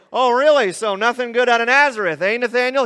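a pretend gotcha, ribbing; mock sarcasm. Jesus pretending to mock Nathanael's Nazareth comment, sarcastic. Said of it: "He doesn't say".

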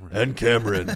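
loud, mock announcement of a co-host; playful loud mimicry; hammed-up introduction.

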